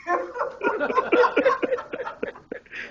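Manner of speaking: a high burst of laughter. Real, not acted. Laughing at his own story.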